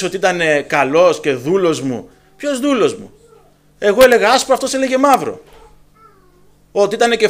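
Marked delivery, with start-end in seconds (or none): 2.4-5.4 indignant retort. Acted.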